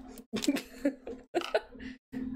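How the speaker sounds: high breathy giggle; laugh. Real, not acted. Crafter admitting she does that a lot, giggling. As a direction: giggle at your own sarcasm.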